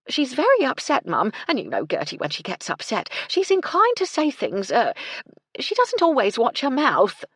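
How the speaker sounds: high, loud, fretting working-class woman; flustered housekeeper. Acted. Housekeeper warns her mistress about the upset maid, flustered.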